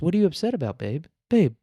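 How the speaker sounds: lightly hammed-up high-pitched concern, dropping on babe; coaxing boyfriend. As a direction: coax her like a mock worried boyfriend. Acts the man coaxing online girlfriend.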